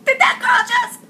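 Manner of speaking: high squeal of disbelief; a high, shocked cry at the screen. Real, not acted. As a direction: shriek it in outrage. Repeating in disbelief.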